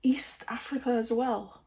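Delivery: a stunned, voiceless whisper of discovery; whispered to herself over her DNA results; awed whisper. Real, not acted. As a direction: breathe it out in a hushed whisper. Reading DNA results in awe.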